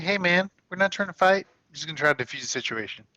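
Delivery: mock friendly. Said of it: "You're just gonna be like".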